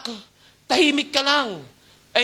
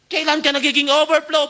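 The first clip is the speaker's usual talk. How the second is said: ordinary talk, nothing marked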